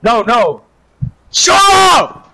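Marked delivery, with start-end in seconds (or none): 1.3-2.3 shouted high and loud at a teammate; angry shout. Real, not acted. Gamer yelling at a loud teammate to shut up, angry shout. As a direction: snap at him to shut up, full force.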